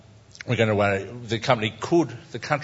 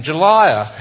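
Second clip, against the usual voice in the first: angry fish; 5 semitones higher, 8 dB louder than usual. Acted. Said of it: "And the angry fish is saying".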